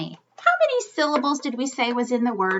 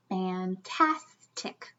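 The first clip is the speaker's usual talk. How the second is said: fantastic stretched slowly, fan-tas-tic; slow syllable split; 5 semitones lower, 8 dB quieter, much slower than usual. Shown as a demonstration. Teacher slowly chunking the word for kids. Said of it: "It's my second syllable".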